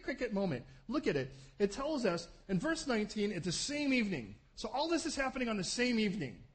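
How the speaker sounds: ordinary delivery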